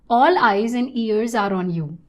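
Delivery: normal talking voice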